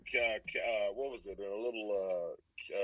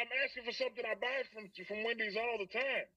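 higher, exasperated retort at the counter; frustrated insisting; 11 semitones higher, 5 dB quieter than usual. Retold or imitated. To the Wendy's workers, exasperated and higher. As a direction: press the point, fed up.

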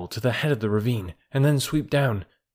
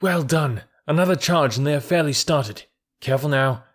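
put-on higher, excited boy urging the herd on; eager calls over galloping cattle; calling out excitedly; 5 semitones higher than usual. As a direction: cheer them on mid-chase. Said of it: "Mowgli called".

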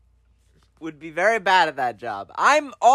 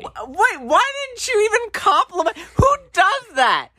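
mock outrage; 12 semitones higher than usual. Real, not acted. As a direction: complain to your brother, scandalized. Protesting brother's self-compliment.

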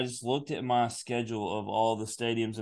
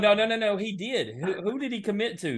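excited protest; 6 semitones higher than usual. Real, not acted. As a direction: protest with playful urgency. Teasing denial of commitment.

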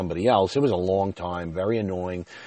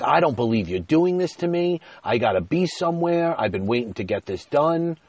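much higher-pitched, aggrieved complaint; retold screaming rant; 7 semitones higher, 3 dB louder than usual. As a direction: re-enact a fed-up rant without full screaming. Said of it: "I started screaming".

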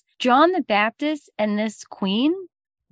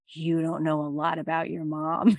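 sly mother; 5 semitones lower, 6 dB quieter than usual. Acted. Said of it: "she was like".

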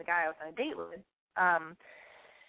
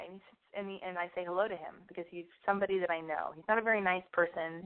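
ordinary delivery

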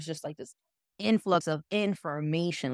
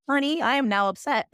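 higher, sassy put-out retort; mock indignation; 8 semitones higher, 4 dB louder than usual. Woman rebutting a nosy questioner, mock indignant. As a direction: playfully announce you are offended.

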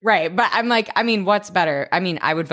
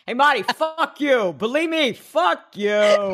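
put-on New York accent; 6 semitones higher, slower than usual. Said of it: "a New York accent".

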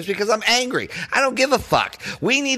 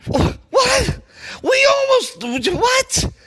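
high, stunned what; disbelief; slower than usual. Real, not acted. Fan stunned by near loss, high incredulous squeak. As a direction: squeak out the question in shock.

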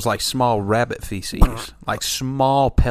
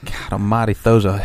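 ordinary talk, nothing marked

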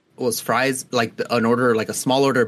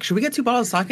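much higher, eager question; excited friend; 10 semitones higher, faster than usual. Retold or imitated. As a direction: pitch it up like a giddy friend. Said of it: "and they were like".

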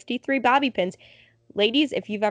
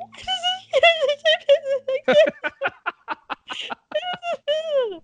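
long high-pitched broken laugh; high helpless laugh. Retold or imitated. Fan retelling her reaction to the loss, long high laugh. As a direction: laugh it out, half-crying. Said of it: "And I'm like".

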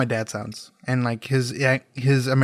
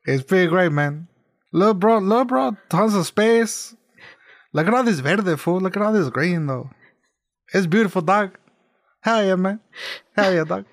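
higher-pitched Chicano bro voice; California Mexican accent; 4 semitones higher than usual. Acted. Fairies admiring all the green, aggressively California Mexican accent. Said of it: "Just very California Mexicans".

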